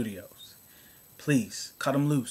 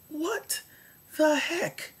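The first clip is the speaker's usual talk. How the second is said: much higher breathy outburst; squeaky incredulous complaint; exasperated what the heck; much slower than usual. Real, not acted. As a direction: whine it up high. Complaining everyone dies.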